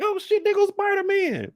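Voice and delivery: high excited gasp; starstruck. Retold or imitated. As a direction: squeal it out like a fan.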